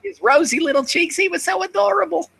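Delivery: very high gushing granny. Acted.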